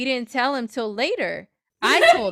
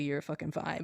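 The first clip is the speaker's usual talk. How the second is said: much softer, muttered exasperation; exasperated under breath; 6 semitones lower, 10 dB quieter than usual. Retold or imitated. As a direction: say it under your breath, fed up. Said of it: "I wanted to say literally".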